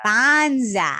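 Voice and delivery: a co-host trying the Aussie word; Australian accent. Acted. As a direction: have a go at saying Bonza like an Aussie. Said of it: "doesn't sound right in American accent".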